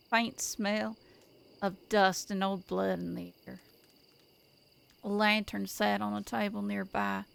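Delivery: calm, measured storytelling read; story narration. A narrator's reading.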